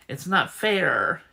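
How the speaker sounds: slow, loud, aggrieved; it's not fair, slow and loud; bitter cry. Acted. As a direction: let the resentment burst out. Bitter outburst.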